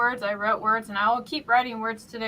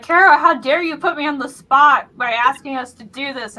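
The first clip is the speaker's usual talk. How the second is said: mock outrage; 5 semitones higher, 11 dB louder than usual. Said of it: "being like".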